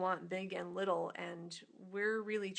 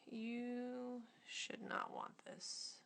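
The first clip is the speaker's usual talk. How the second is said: hushed critic voice; 4 semitones higher, 7 dB quieter, much slower than usual. Retold or imitated.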